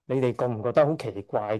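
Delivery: usual speaking voice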